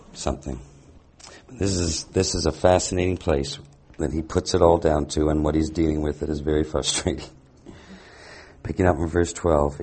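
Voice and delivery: usual speaking voice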